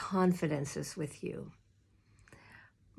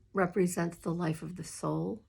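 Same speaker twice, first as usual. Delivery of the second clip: ordinary talk, nothing marked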